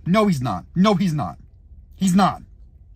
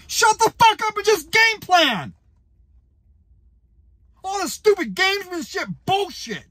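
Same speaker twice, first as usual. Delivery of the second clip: high yelling shut the fuck up; furious yell; 11 semitones higher than usual. Real, not acted. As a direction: scream it at the coach. Furious rant at coach.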